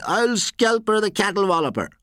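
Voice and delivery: high-pitched yelled taunt; child's scream. A narrator's reading. Child yelling from the bridge at the poacher, screamed. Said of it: "a child from the bridge screamed".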